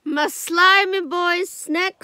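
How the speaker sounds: slow, high-pitched cooing; doting affection. Real, not acted. Viewer greeting a favourite slimy character, doting coo. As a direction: coo it slowly, every word stretched out.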